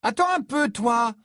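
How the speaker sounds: high, loud call to halt; indignant cry. A narrator's reading. Hermione stopping a fourth-year who pushed her, crying out. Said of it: "s'écria Hermione".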